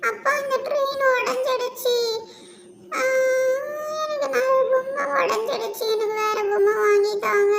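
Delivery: put-on high, loud childish wail; hammed-up little-kid crying. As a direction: whine tearfully to father for another toy. Child cries over broken toy.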